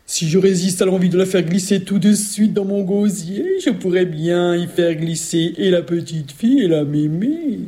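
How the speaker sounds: scheming ogress voice; ogress thinking aloud. Acted. Ogress plotting to swallow both, scheming ogress voice. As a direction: relish the plan, drawing out the ends. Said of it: "se dit Logresse".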